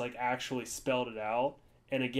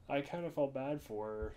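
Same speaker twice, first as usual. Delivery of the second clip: ordinary talk, nothing marked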